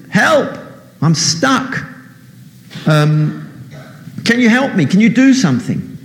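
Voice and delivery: high pleading cry for help; panicked plea. Acted. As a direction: beg for rescue, voice up high. Stuck man pleads from cliff.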